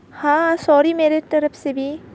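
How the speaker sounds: loud, testy acted sorry; forceful, grudging repeat of the apology; heated apology. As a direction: apologise as if it were a threat. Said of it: "गर्म लहजे से बोल रहे हो ... धमकी ज़्यादा लग रही है".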